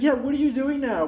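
high, bright mock girl voice; imitating the chatty girl.

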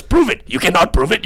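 high, defiant mock whine; mocking Akbar. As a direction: squeak it back like a childish dare. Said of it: "And he goes".

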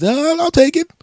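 high, fast put-on voice; mock neighbor. The neighbor protesting the thrown hot dog, high mock voice. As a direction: squawk the question like the neighbor. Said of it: "he's, he's".

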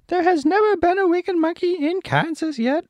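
very high squeaky monkey voice; Monkey King, stern. Acted. Monkey King answers Dorothy's summons, high squeaky royal voice. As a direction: squeal it out as a cartoon monkey ruler.

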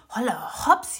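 plötzlich hoch und laut aufgeschreckt; erschrocken japsend. A narrator's reading. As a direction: schreck hoch wie beim Japsen. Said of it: "japste Raffi erschrocken". (Raffi hört ein Grollen, erschrocken japsend)

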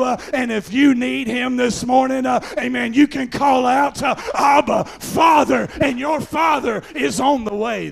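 high, driving revival preacher; full-force preaching. Real, not acted. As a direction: build to a loud, rhythmic pulpit shout.

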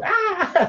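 very high little squeal; cute high-pitched scream; adorable mock scream. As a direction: squeal like a startled kid. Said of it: "Like you're screaming, adorably so".

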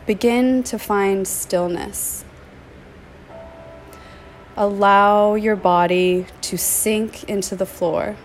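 soothing yoga nidra voice, phrase by phrase; guided meditation. Acted. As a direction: gently guide them into stillness, stretching key words. Leads a meditation.

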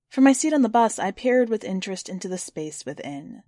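ordinary delivery

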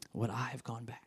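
breathy whispered question; whispered self-examination. Real, not acted. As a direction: whisper it to yourself, searching.

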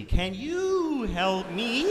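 very drawn-out, high, sliding tone; eerie sing-song plea, very slow; tuning to his frequency. Retold or imitated. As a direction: stretch it high like tuning a radio. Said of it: "she's trying to find his frequency".

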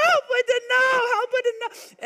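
put-on a toddler with cold, snowy hands wailing for help; toddler crying for help. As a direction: imitate a two-year-old begging for help. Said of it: "he'd be like".

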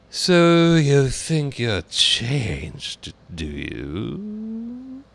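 very slow, high drawling caterpillar. A narrator's reading. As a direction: draw out every word. Caterpillar voiced in story.

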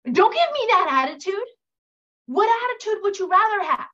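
high, loud mom scold. Retold or imitated. Mom scolding child's attitude, high and loud. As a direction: snap it like a fed-up mother. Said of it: "what do we say as moms".

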